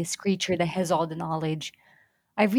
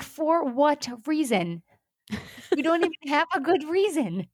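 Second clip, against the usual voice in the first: incredulous; 7 semitones higher than usual. Retold or imitated. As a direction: demand a reason in disbelief. Incredulous retort to character.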